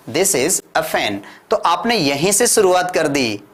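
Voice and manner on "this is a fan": slow, clear classroom drill; slow model sentence. Shown as a demonstration. Teacher drilling simple sentences slowly for learners. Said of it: "आपने कह दिया".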